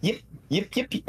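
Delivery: high-pitched fox yips at the imp; fox yipping. Acted. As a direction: yap like an excited little fox. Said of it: "fox will say to you".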